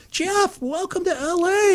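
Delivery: very high, sing-out welcome; gushing greeting. Retold or imitated. Wife welcoming visitor to L A, high and gushing. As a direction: imitate an excited hostess greeting a guest.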